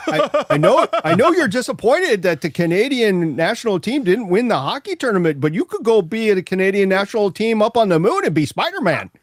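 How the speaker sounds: put-on high, laughing Canadian accent; Canadian accent through laughter. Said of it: "you do a better Canadian accent".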